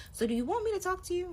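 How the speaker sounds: squeaky, high mock-exasperated question; mock him with a cartoonish high voice.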